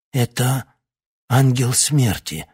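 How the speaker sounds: hoarse cry. Acted. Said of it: "Старик прохрипел".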